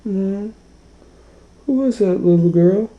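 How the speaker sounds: high-pitched little cub voice; high, childlike puzzled question; Baby Bear. Acted. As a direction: ask it like a small, curious bear. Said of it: "and then Baby Bear goes".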